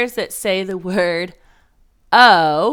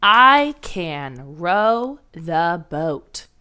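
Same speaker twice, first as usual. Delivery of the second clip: very slow, word by word; dictating a sentence to the class; slow dictation; much slower than usual. Shown as a demonstration. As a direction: spell out each word slowly for first graders. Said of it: "Our sentence goes like this".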